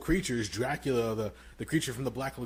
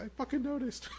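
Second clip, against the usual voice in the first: high, triumphant squeal of pride; telling the director you caught the detail; proud gotcha. Retold or imitated. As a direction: crow it at the director. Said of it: "I'm like".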